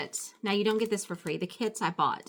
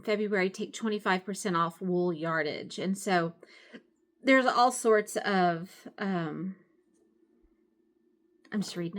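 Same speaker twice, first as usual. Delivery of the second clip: usual speaking voice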